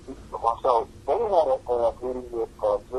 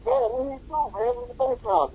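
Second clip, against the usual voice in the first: pitched way up to play the guys; comic high-voiced impression; high mock voices. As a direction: chirp it like the crew.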